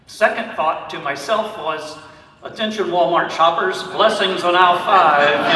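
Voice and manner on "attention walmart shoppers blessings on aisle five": playful supermarket tannoy call; mock store announcement. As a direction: announce it to the whole store, slowing at the end. Imagined store announcement as joke.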